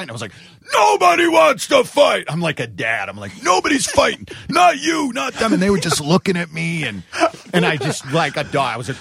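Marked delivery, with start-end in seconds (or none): 0.7-2.2 shouting to stop fight. Retold or imitated. To drunk bandmates and hockey players, commanding shout. 3.4-5.5 high, firm scolding; stern command. Retold or imitated. To both groups squaring up, stern scolding. Breaking up bar fight.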